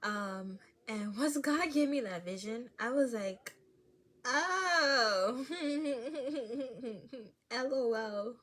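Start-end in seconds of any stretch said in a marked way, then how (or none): 4.2-8.4 loud laugh through the words; embarrassed laughing. Real, not acted. Vlogger realizing she was annoying, laughing loudly. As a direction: crack up at yourself.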